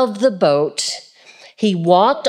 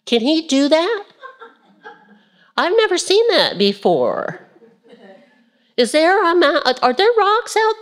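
amazed disciples; 10 semitones higher than usual. Retold or imitated. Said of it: "I think they were like".

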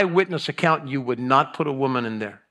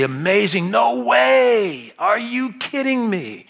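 amazed; 7 semitones higher, slower than usual. Shown as a demonstration. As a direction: act stunned by something incredible. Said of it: "something that's absolutely incredible".

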